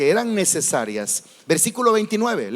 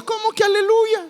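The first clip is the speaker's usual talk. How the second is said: much higher, indignant disbelief; incredulous; 13 semitones higher than usual. Real, not acted. Preacher scolding a misplaced hallelujah, shocked. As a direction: squeak out the question in shocked disbelief.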